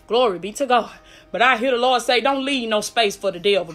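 ordinary talk

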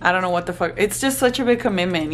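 ordinary delivery

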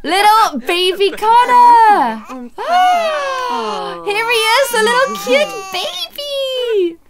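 high, slow, cooing. Real, not acted. Streamer cooing at the newborn sim, slow and high. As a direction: coo over him like a newborn. Cooing over the new baby.

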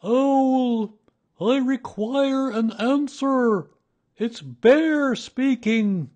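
high, drawn-out booming holler; very loud call. A narrator's reading. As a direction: call out slowly at full volume as Bear. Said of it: "in a very loud voice".

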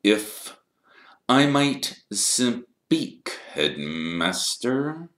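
very slow, deliberate interjection; silky Snape. A narrator's reading. Snape addressing the headmaster from the shadows, slow and deliberate. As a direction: draw each word out with menace.

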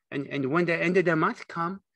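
put-on high, childlike prompting; eager Lenny. A narrator's reading. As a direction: stammer eagerly, prompting the story. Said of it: "Lenny looked eagerly at him".

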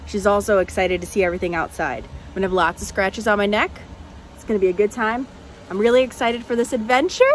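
ordinary talk, nothing marked

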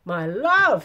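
very loud outburst; horrified cry. A narrator's reading. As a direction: cry out in appalled protest. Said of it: "he cried".

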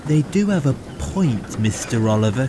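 gently siding with the others; conceding. A narrator's reading. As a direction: concede the point politely. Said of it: "conceded Toad".

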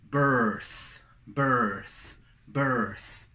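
"Birth" falling, with final th; long er then th in "Birth"; th as in Venancio. Shown as a demonstration. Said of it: "Con Venancio y redonda". ("Birth" con Z de Venancio)

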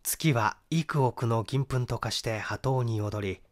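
ordinary delivery

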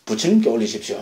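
a hint of a humble monk declining the gift. Monk politely refusing the devotee's mango, low and soft. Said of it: "하면 어떻게 합니까?".

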